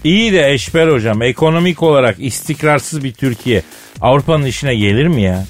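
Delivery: ordinary delivery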